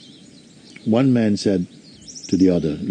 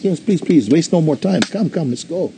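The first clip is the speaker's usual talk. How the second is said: urgent; 8 semitones higher than usual. Retold or imitated. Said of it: "She says".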